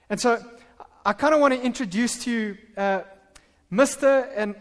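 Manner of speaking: ordinary talk, nothing marked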